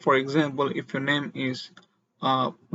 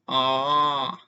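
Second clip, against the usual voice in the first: AH falling then rising, drawn out; long dipping AH, as in realising something; third tone. Shown as a demonstration. On suddenly knowing something, AH in dipping third tone. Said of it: "when you know something, you'll be like".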